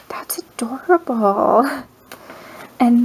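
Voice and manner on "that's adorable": hushed delight. Real, not acted. Admiring a postcard from a friend, whispered. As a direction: breathe it out in a whisper.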